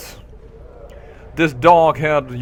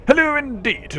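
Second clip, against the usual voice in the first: haughty armoire; 11 semitones higher than usual. Acted.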